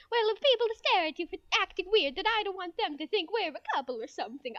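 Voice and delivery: high, loud mock Italian; bad Italian accent. Mascot, now Italian, answers the friend in a high mock Italian accent. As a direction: ham up a shaky attempt at an Italian accent. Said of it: "There it is, there's the voice".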